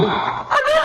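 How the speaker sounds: sudden high frightened cry; alarmed. Acted. Wife hearing her husband can't breathe, alarmed cry. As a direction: shriek it in panic.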